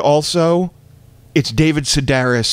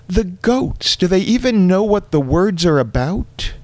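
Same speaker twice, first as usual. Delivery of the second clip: mother's voice; 8 semitones higher than usual. Retold or imitated.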